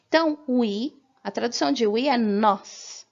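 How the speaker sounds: normal talking voice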